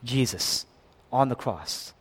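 ordinary delivery

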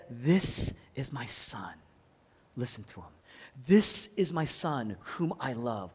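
low, soft and slow, a gentle heavenly father; voice of God. Acted. God the Father softly naming his beloved son. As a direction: speak as God, softly and slowly. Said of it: "God speaks and says".

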